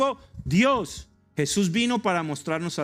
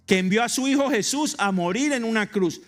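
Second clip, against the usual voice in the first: fiery preaching; 8 semitones higher, 5 dB louder than usual. Acted. Preacher calls the congregation to believe, in fiery sermon voice. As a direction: preach it with rising fervor to the congregation.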